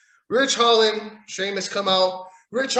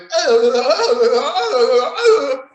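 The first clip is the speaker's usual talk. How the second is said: big burst of laughter; cracking up; loud laugh; 7 semitones higher, 9 dB louder than usual. Real, not acted. Laughing at wrestlers' antics.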